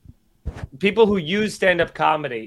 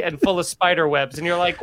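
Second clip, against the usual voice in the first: ordinary talk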